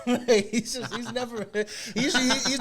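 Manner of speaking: laughing. Real, not acted. Laughing at [name] joke.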